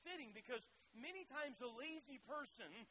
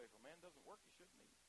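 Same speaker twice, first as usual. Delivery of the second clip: hushed quote; 6 semitones lower, 9 dB quieter than usual. Acted. Quoting Paul, punchline drops away.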